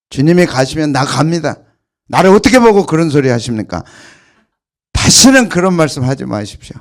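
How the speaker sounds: loud, proud declaration of loyalty; bold boast. Acted. Preacher voicing Peter boasting to Jesus, loud and defiant. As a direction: shout it like a proud disciple.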